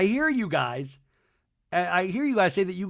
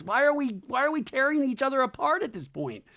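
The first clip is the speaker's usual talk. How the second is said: high-pitched pleading question; imitated exasperation; 10 semitones higher than usual. Wife asks why friends tear each other apart, mock exasperation. Voices wife's frustrated question.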